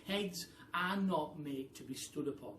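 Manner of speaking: ordinary delivery, nothing marked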